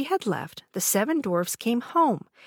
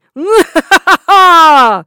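loud, high bitter witch laugh; evil cackle; 10 semitones higher, 20 dB louder than usual. A narrator's reading. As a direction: cackle in cruel triumph. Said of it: "laughed bitterly".

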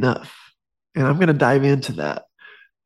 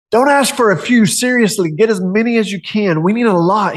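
urgent prophet; 6 semitones higher than usual. Acted. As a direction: urge her to gather every jar. Elisha urging widow, louder.

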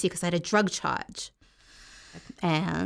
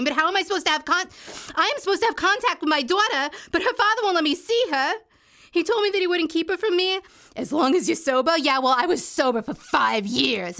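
frustrated outburst; 7 semitones higher than usual. Acted. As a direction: pitch climbing high in bitter frustration. Frustrated mother denied her kids.

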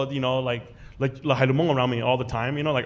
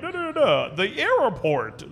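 mock gibberish; 6 semitones higher, slower than usual. Mocking city-talk example.

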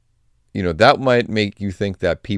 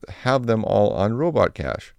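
normal talking voice

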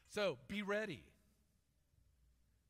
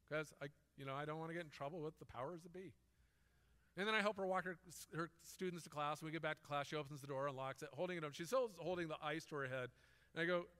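ordinary talk

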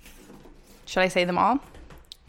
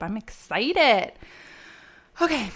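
ordinary talk, nothing marked

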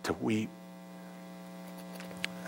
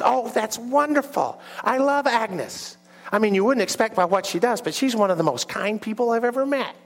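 put-on New York character, pitched way up; New York accent; 9 semitones higher than usual. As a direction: gush in a high New York voice. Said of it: "have a New York accent".

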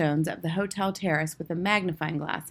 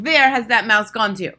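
loud, raised, angry demand; forceful witch's bark; snapping witch; 4 semitones higher, 10 dB louder than usual. A narrator's reading. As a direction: snap the question as you search. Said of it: "snapped the Grand High Witch".

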